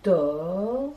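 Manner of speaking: tor dit seul, appuyé; détachez bien tor; syllabe isolée. Shown as a demonstration. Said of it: "la syllabe". (syllabe tor appuyée)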